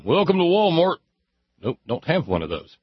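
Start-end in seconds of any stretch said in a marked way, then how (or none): 0.0-1.0 higher, louder greeter welcome; store greeter voice. Retold or imitated. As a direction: greet customers at the door. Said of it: "I don't have a night job saying".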